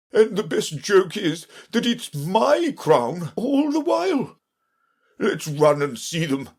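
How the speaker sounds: high, excited king's voice; lively high-pitched royal chatter. A narrator's reading. King delighted by his own joke, eager.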